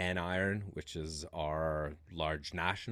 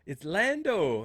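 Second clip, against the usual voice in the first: P=A; slow emphatic correction; 12 semitones higher, 8 dB louder, slower than usual. Retold or imitated. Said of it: "He's like".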